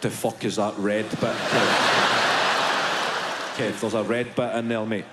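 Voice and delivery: low calm buddy voice; low coaxing inner voice. Acted. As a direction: talk Kev through it like a mate. Gut's inner voice coaching Kev.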